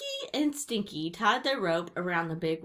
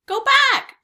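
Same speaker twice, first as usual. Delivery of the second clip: very high, loud parrot screech; squawking parrot; 14 dB louder than usual. A narrator's reading. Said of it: "she squawked".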